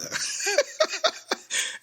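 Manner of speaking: real high-pitched laugh bursting out; high breathy laugh. Real, not acted. Laughing at the absurd cancer attack story, high squeal.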